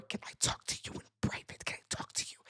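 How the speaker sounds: hushed, fast whisper. Retold or imitated. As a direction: whisper it from the doorway. Whispered plea from bedroom door.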